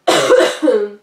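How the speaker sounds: loud chesty cough; hard cough. Real, not acted. She is sick and coughing.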